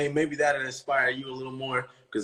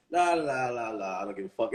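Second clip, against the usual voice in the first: carefree singsong; 5 semitones lower, 7 dB quieter than usual. Retold or imitated. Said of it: "This what you did".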